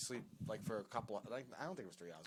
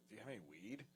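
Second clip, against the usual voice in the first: furtive asking; 13 dB quieter, much faster than usual. Retold or imitated. Guest slips Sal a question outside, furtive and hushed. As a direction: ask it softly on the sly.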